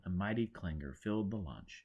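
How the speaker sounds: ordinary delivery, nothing marked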